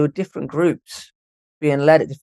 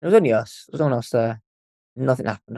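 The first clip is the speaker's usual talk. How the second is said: normal talking voice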